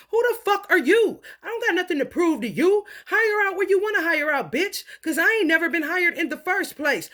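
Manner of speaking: heated, high-pitched challenge; angry confrontation. Real, not acted. Telling off someone testing the skill set, angry confrontation. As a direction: tell them off with rising indignation.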